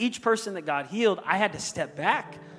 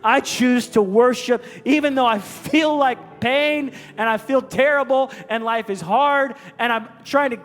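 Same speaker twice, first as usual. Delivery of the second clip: preaching at full force; 6 semitones higher, 8 dB louder than usual. Real, not acted. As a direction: declare it to the whole room.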